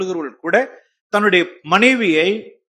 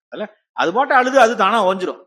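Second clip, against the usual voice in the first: usual speaking voice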